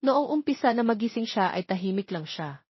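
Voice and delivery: ordinary delivery, nothing marked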